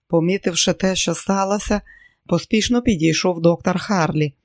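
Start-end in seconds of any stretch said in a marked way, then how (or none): none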